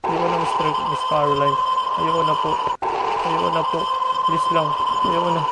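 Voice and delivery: high, frantic begging; panicked pleading. Real, not acted. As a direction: beg in panic. Pleading while fleeing.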